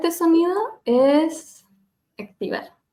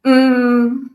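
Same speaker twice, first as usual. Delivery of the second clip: hard attack; 8 dB louder than usual. Shown as a demonstration. Coach showing students how not to start the hum. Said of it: "Y no partir muy fuerte".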